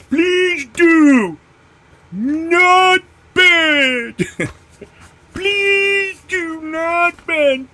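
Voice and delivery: high, slow, shouted mocking sing-song; mock label voice. Mocking the seller's fragile label, high and drawn out. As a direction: ham up the package's pleading label.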